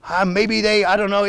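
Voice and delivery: ordinary talk, nothing marked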